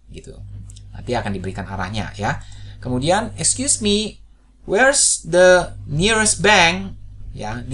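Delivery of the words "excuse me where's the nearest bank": high, very slow and clear; teacher drilling a question; slow demo. Shown as a demonstration. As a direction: stretch each word for learners. Teacher models English question.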